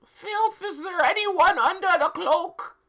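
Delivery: high squeaky questioning voice; high character voice. Acted. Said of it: "he asked".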